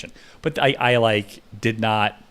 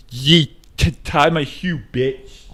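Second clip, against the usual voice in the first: lightly put-on slurred voice, a touch higher; 5 semitones higher, 5 dB louder, slower than usual. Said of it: "wrist and go like this".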